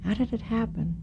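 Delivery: softly, gingerly asked; hushed funeral question. Acted. Burt's character asks the widow, hushed.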